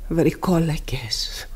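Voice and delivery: slow, breathless whisper of dread; horrified whisper. Acted. Mother whispers to the pastor in horror, stunned whisper. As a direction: breathe the word out as if seeing ghosts. Mother in shock after the scene.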